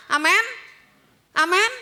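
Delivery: loud, rising Amin; calling for amen. Real, not acted. Preacher calls the congregation to answer, loud rising. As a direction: draw an amen from the crowd.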